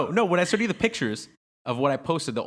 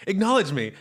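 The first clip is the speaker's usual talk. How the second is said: higher, louder mocking plea; mock attention-seeking demand; 7 semitones higher, 5 dB louder than usual. Mimicking a follower looking out to the crowd, mock demanding. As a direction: imitate a dancer begging for notice.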